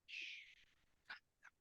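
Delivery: hushed calming whisper; barely voiced, calm down. Shown as a demonstration. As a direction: soothe him in a whisper. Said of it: "speaking a whisper".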